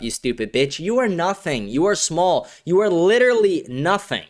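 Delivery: usual speaking voice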